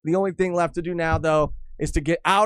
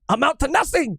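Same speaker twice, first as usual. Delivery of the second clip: high shouted finish; angry Vegapunk; 9 semitones higher than usual. Retold or imitated. Host jumps in to finish the angry rant, higher pitch. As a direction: cap the insult with a shriek. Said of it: "angry Vegapunk would say".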